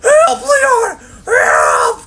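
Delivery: shrill, very high, loud mock cry for help; mock helpless cry. Mocking Ashley stuck in the bulldozer, hammed-up cry for help. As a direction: whine help like a damsel in distress. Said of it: "sits there and goes".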